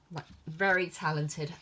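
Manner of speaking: normal talking voice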